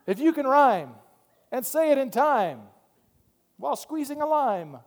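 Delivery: high, emphatic rhyming cadence; mock rhyming preacher. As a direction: hammer out the rhyme like a showy preacher. Mocking rhyming preachers with improvised rhyme.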